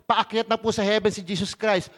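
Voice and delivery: usual speaking voice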